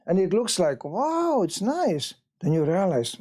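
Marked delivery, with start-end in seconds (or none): 0.8-2.1 impressed wow. Retold or imitated.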